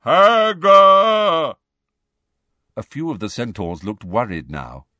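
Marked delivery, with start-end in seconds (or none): 0.0-1.5 long, high giant bellow; drawn-out roar of a word. A narrator's reading. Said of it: "then bellowed".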